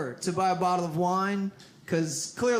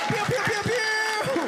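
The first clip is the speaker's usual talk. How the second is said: finger gun sounds; 11 semitones higher, slower than usual. Retold or imitated. Party bro firing finger guns.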